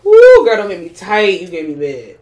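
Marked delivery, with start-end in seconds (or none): 0.0-0.6 loud high whoop; whooping surprise; shocked woo. Real, not acted. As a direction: blurt out shocked. Shocked outcry at offer.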